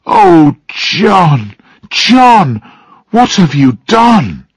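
high, drawn-out words of dismay; horrified. A narrator's reading. Mary appalled at what John has done, horrified. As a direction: build to a high, appalled cry.

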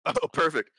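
ordinary talk

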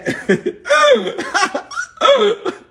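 high, loud peal of laughter; big laugh. Real, not acted. Priest after his órale punchline, high laugh. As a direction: crack up in a high cackle.

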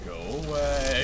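high drawn-out. Pretend. Repeated, higher and slower.

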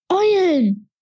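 atemlos hervorgestoßen, deutlich höher; keuchend. A narrator's reading. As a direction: ein Wort, außer Atem gekeucht. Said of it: "keuchte er". (keuchend und atemlos gerufen)

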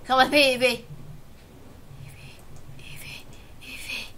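whispered pleading; coaxing whisper. Real, not acted. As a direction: whisper its name, willing it in. Coaxing Eevee into the ball.

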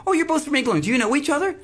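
high-pitched imagined fellow passenger; mock a naive stranger's question, pitched well up; curious stranger. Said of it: "I half expected one of them to say".

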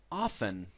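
often said /afen/, silent T, American; often without T. Shown as a demonstration. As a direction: say often with no T sound. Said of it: "Yo la T no la pronuncio".

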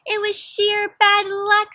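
high, loud, slow plea; pleading excuse. Acted. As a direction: beg to be believed. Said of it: "said Wendell and Floyd".

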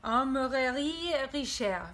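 put-on shop name in a careful French accent; French pronunciation of the shop name. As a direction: say it like a proud Parisian. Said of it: "in my best French accent".

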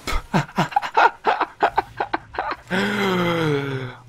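high, breathy staccato laughter; long laughing fit. Real, not acted. Player cracking up at the game, high helpless laugh. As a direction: crack up, ending in a long sigh.

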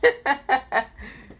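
high-pitched cackling laugh; maniac laugh. Acted. Deku laughing at the enemies, maniac cackle. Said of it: "laughing like a maniac".

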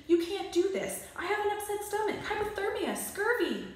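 high, loud, fast list of ailments; melodramatic pleading. Acted. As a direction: overact like a doomed patient. Timmy begs mother dramatically.

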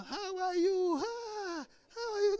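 high, sugary fake greeting; fake sweet greeting. Couple greeting a visitor mid-quarrel, high sugary voice. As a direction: sing it out with a borrowed smile.

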